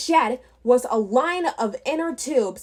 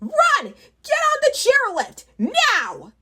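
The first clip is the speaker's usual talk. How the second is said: panicked goblin; 12 semitones higher than usual. Acted. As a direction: bark orders in a squeaky panic. Goblin fleeing orders others.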